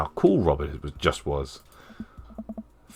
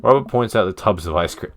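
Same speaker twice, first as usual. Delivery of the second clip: ordinary delivery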